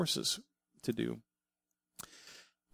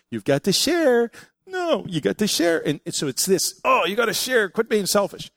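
high, louder scolding parent voice; nagging parent; 8 semitones higher, 5 dB louder than usual. Retold or imitated. Parent scolding a child to share, high and insistent. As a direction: lecture the kid to share. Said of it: "we always have to teach our children".

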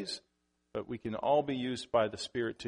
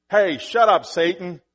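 loud hammed-up snap; a father's mock yell at his daughter, loud and over the top; 5 semitones higher, 12 dB louder than usual. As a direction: pretend to shout at your daughter. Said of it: "That's a little over the top".

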